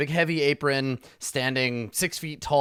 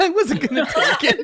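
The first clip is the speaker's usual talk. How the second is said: high quick giggly reply; laughing; 7 dB louder, faster than usual. Real, not acted. Player defending themself amid table laughter, laughing.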